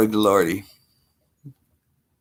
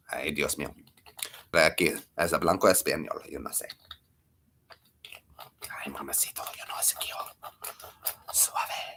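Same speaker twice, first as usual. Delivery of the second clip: put-on soft, low Spanish-accented murmur; mock Spanish fading into a whisper; hushed, playful Spanish accent; 7 semitones lower, 12 dB quieter than usual. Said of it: "We drop into Spanish".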